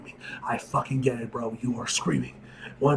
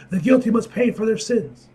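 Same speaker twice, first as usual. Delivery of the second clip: angry proclamation; 9 semitones higher, 8 dB louder than usual. Acted. Character demands vengeance.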